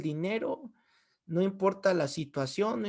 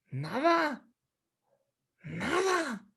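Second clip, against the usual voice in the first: emphatic high answer; 7 semitones higher, 4 dB quieter, much slower than usual. Real, not acted. Answers own rhetorical question, drawn-out.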